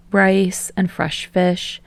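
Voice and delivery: ordinary delivery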